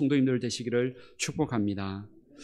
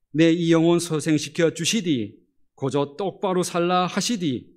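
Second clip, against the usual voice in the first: Pyongan dialect; 3 semitones higher, 6 dB louder than usual. Shown as a demonstration. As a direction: read it like a bold Pyongan northerner. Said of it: "평안도 버전으로 앞부분을 제가 한번".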